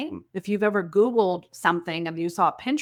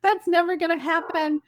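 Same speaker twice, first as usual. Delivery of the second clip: mock disbelief; 11 semitones higher than usual. Mock disbelief at friend's goal.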